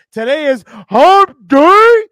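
slow, booming hump-day announcement; hype shout. Acted. Host hyping listeners that it's hump day, booming slow shout. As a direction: drag it out big and loud like a hype man.

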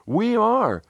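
high cheerful call from the group. A narrator's reading. Team members answering newcomer in chorus, calling out high. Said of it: "they called out".

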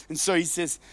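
usual speaking voice

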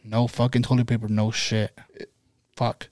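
low muttered frustration; mild disgusted mutter. Retold or imitated. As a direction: grumble it under your breath, fed up. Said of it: "and I'm like".

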